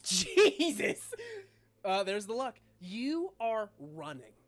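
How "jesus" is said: high, loud laughing exclamation; real astonishment. Real, not acted. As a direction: burst out laughing at the dice roll. Game master reacts to the roll.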